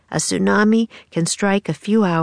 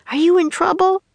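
much higher, worried voice; worried girl; 12 semitones higher than usual. A narrator's reading. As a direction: ask him anxiously. Annie worried, calling to Jack.

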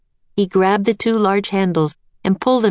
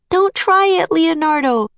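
yelling; 11 semitones higher than usual. A narrator's reading. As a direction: yell to stop him. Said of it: "yelled Annie".

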